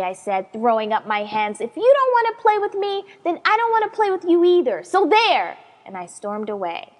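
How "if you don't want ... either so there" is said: high, loud and fast sulking; angry outburst. Acted. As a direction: shout it in a childish huff. Child storms off in a huff.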